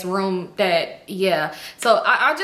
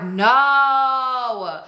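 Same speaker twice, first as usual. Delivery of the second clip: higher, drawn-out no; exasperated refusal; 4 semitones higher, 3 dB louder than usual. Retold or imitated. Friend won't let [name] finish, drawn-out exasperated no. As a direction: stretch the refusal out, pitched up.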